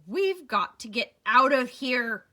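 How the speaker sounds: loud, croaking urgency; croaked. Acted. Eddie urging escape from the island, croaking. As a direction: rasp it out like a bird. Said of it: "he croaked".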